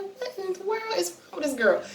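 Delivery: high, hushed disbelief; bewildered. Retold or imitated. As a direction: re-enact baffled muttering to yourself, pitched high and soft. Acts out bewildered reaction to sister.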